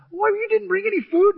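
high-pitched, disbelieving question; incredulous protest. Retold or imitated. Football player questions his guide about food, incredulous. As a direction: protest in alarm, pitch jumping up. Alarmed star in acted survival argument.

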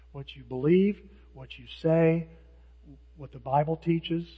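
ordinary talk

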